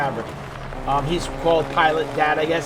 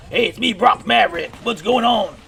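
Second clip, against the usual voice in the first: higher, louder puppet pilot greeting; Brock Maverick voice; 6 semitones higher, 5 dB louder than usual. Acted. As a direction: push the voice up for the old pilot. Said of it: "That's how he talks".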